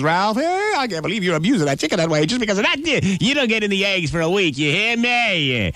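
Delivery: high, fast scolding mother's voice; scolding mama. Acted. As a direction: tear into the boy like a fed-up mom.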